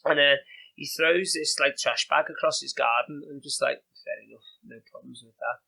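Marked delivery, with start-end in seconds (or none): 4.0-5.6 muttered under the breath; low, resigned aside; quiet mock shrug. Acting out a shrugging reaction.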